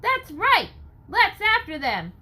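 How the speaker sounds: very loud, high crowd shouts; rallying cries. Acted. Crowd rallies to chase the marines, loud cheering shouts. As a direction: roar agreement, ready to chase.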